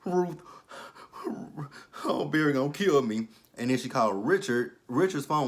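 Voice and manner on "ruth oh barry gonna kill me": a hammed-up frantic mother; panicked. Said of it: "She's freaking out".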